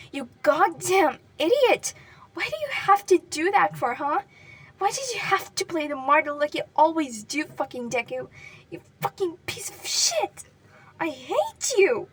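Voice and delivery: loud, high, anguished scream. Acted. Katsuki screaming at Deku's pale face, anguished. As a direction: scream the grief out at him. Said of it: "he screamed at Deku's pale".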